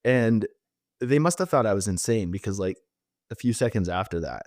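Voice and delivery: ordinary talk, nothing marked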